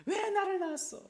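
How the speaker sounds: put-on high, shrill-free childish complaint; whining child. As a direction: sulk like a resentful child. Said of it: "자식이 제일 해서는 안 되는 말".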